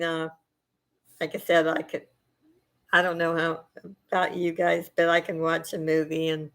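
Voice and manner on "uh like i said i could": ordinary talk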